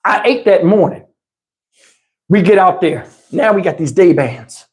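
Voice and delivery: usual speaking voice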